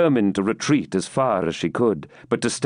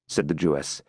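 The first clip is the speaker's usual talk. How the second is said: ordinary delivery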